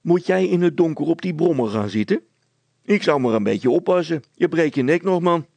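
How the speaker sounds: high, loud officious scolding; meddlesome. A narrator's reading. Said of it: "vroeg de veldwachter bedillerig".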